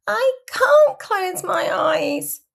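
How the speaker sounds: high, slow, loud whine; whiny child refusing to sleep; bedtime child's complaint. A narrator's reading. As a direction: draw it out like an overtired little kid. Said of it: "you mumble".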